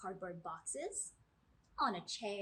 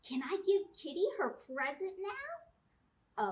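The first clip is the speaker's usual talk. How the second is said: put-on higher, louder excited child voice; eager little girl; 8 semitones higher, 6 dB louder than usual. Chloe begs to give Kitty her present. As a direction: ask like a kid who can't wait.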